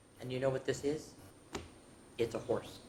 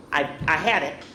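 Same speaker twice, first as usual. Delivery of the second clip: ordinary delivery, nothing marked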